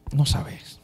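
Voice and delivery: hushed aside; whisper. Acted. Preacher answers his critics, whispered. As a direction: breathe it out, unvoiced.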